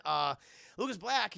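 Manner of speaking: usual speaking voice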